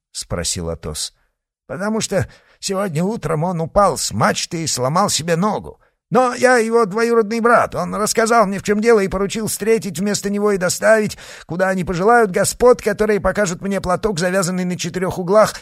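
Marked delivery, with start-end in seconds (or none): 1.7-14.2 higher put-on Irish accent. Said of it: "имитируя ирландский акцент". 14.2-15.5 завязанный на четырёх углах in a higher, fast put-on Irish accent; завязанный на четырёх углах in a fast fake Irish brogue. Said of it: "имитируя ирландский акцент".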